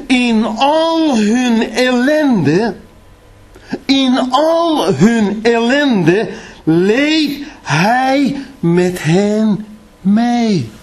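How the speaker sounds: high, very slow, drawn out word by word; slow solemn reading. Acted. Preacher reading Isaiah to the church, slow and solemn. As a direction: let every word of "ellende" hang.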